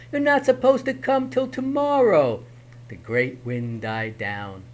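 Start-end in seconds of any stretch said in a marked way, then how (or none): 0.1-2.4 high, frantic protest; panicked. Acted. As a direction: panicky pleading. Herschel continues panicked.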